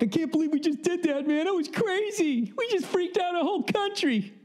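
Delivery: giddy high-pitched excited squeal; mock hyped-up dictator, very high; amped up. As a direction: gush like you're too wired to sleep. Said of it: "so fucking amped up".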